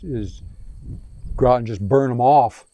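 usual speaking voice

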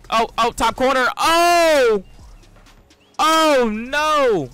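high, loud groan of disbelief; real frustrated shout; anguished yell. Real, not acted. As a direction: scream at the missed chance. Near miss on open goal.

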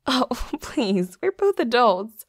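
loud, amused brush-off; laughing protest. Acted. Laughing off a roommate's embarrassment, amused protest. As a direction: laugh it off loudly.

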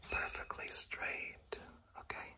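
hushed, drawn-out whisper; soft whispered emphasis; slow whisper. Real, not acted. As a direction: whisper it slowly and precisely. Drops into whisper while teaching.

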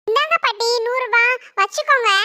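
put-on little girl voice. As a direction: speak like a small girl. Little girl gives money.

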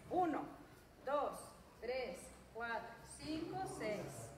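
drawn-out, unhurried counting; slow count. Shown as a demonstration. Coach counting slow punches for the class, drawn out. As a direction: count slowly so the class keeps pace. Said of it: "dos rectos despacio".

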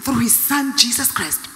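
preaching at full force. Acted. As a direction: rouse the church, voice climbing. Preaching rises.

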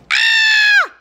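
very loud, high-pitched scream; short piercing shriek of exasperation; exasperated scream. Real, not acted. Seeing friends' concert shirts, exasperated scream.

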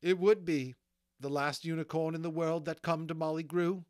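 high, loud, aggrieved complaint; sniffy bitterness. Acted. Molly laments which unicorn came to her, sniffing. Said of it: "Molly sniffed".

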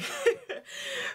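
sudden high breathy laugh; high burst of laughter. Real, not acted. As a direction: cackle at the drama. Host laughs at the scene.

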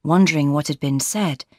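usual speaking voice

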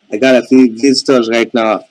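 loud, to carry down a bad phone line; calling out so she can hear him. Real, not acted. Caller explaining visitors at his door over a bad line, loud.

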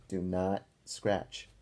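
slow, deliberate do not scratch; firm rule. Real, not acted. Teacher warning the children about itchy spots, slow and firm. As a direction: spell out the rule word by word.

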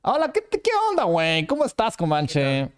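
Mexican accent. Shown as a demonstration. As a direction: play up a Mexican streamer greeting a friend. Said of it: "Mexicano sería así".

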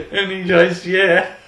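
loud, high, fresh out of a laugh; incredulous laughing retelling. Real, not acted. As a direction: blurt it out while cracking up. Still cracking up at preacher's answer.

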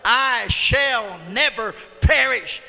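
loud, slow proclamation; preacher's drawn-out, ringing affirmation; emphatic declaration. Shown as a demonstration. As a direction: belt out each word deliberately. Models emphatic personal declaration.

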